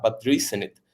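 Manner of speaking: ordinary talk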